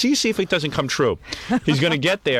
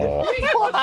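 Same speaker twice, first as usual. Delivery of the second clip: high braying hee-haw; donkey bray. Pretend. Teasing Gary as a donkey, shrill bray.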